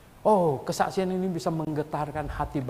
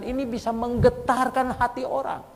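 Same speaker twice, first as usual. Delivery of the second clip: fervent preaching; 9 semitones higher than usual. Acted. Preacher proclaiming to the congregation, high and fervent. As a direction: proclaim it to stir the church. Preacher repeats key line.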